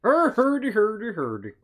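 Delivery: high squeaky Irish leprechaun. Pretend. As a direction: chirp it like a leprechaun. Mock Irish jig noises.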